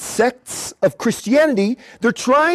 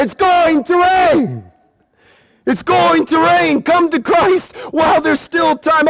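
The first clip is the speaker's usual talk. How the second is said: slow, higher call to the back rows; calling to far back; 7 semitones higher than usual. Acted. Preacher calling to the back rows, raised and slow. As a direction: project to the people way out there. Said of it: "those of you way out there".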